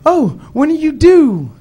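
high, loud, sing-song shop lady; chirpy counter lady. Retold or imitated. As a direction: play the cheery cashier. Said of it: "the lady at the counter said".

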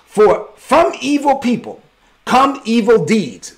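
slow, high, solemn proverb; proverb intoned. Acted. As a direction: intone the saying slowly. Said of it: "As the old proverb says".

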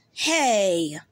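usual speaking voice

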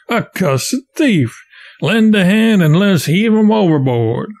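scornful growl. Acted. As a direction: sneer and order him thrown over. Child of calamity condemns boy.

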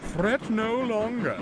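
booming, slow proclamation from the pompous engine; grand declaration. Acted. Pompous engine announcing to the station, grandly. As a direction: declare it like a self-appointed savior. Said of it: "he declared".

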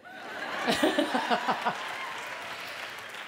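laughing. Real, not acted. Laughs after "let's go.

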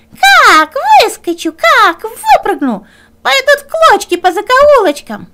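high, loud threatening fox; menacing fox. Acted. Fox shouts threats from the stove, high and loud.

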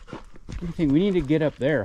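ordinary talk

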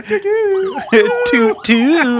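mock siren toot. Siren noise for cop theme.

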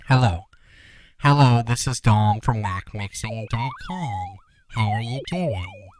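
plugin-altered throat timbre; vocal effect. Shown as a demonstration. Tutor showing custom graph points, processed voice. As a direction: sound through the tweaked throat curve. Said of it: "You can get some really cool vocal effects".

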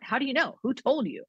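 mock upset. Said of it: "she kinda got mad".

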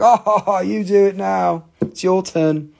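high, loud laughing taunt; mock gleeful taunt. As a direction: hand it over with a gloating laugh. Imagines handing act to family.

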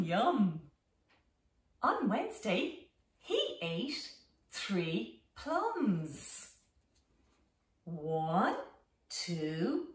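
ordinary delivery

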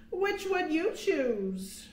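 plain reading voice

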